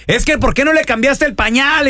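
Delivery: mock quarrel. Host imagines the couple fighting over her care, mock quarrel. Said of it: "imagínate que se estén peleando".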